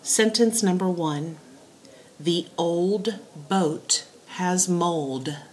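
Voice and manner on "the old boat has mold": very slow, word by word dictation. Shown as a demonstration. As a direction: dictate it slowly for writing down. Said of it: "give you your three dictation sentences".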